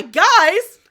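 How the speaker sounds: high, loud interrupting call. Acted. Distracted while Bond is busy downstairs, calling out.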